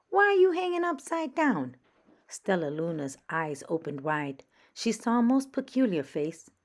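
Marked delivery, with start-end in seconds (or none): 0.1-1.8 high, loud curious question. Acted. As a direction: ask loudly and boldly. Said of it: "a loud voice said".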